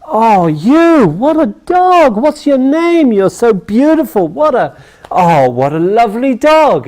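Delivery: put-on high, cooing baby talk to a pet dog; fawning sales rep cooing at the dog. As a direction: gush over the dog like it's a puppy.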